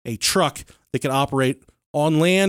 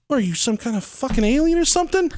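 pitched way up in disbelief; higher, mock-shocked girlfriend; incredulous outburst; 8 semitones higher than usual. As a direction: blurt it out, stunned and high. Girlfriend shocked at weird request.

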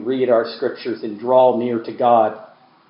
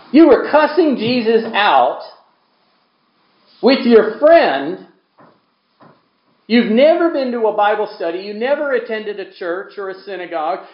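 preaching at full force; 9 semitones higher, 3 dB louder than usual. Acted. Preacher pressing the congregation about the thief, preaching hard. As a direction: build it up to a shouted question.

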